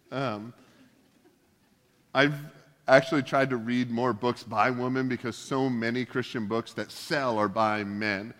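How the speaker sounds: ordinary talk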